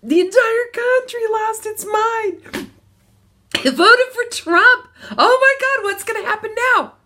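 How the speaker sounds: hammed-up hysterical wail; mock national panic. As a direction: play the whole country freaking out. Voicing the country's panic.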